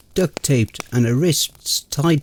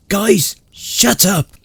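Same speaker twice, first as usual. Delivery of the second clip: slow hushed snap; snapped; 7 semitones higher, slower than usual. Acted. Narrator silences arguing friends, sharp snap. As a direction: cut them off hard. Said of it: "I snapped".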